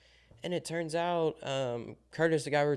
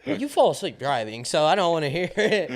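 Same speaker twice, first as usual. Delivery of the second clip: louder, laughing jab; teasing laugh; 6 semitones higher, 10 dB louder than usual. Real, not acted. Teasing the friend who falls asleep at the wheel, laughing loud. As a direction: poke fun, laughing.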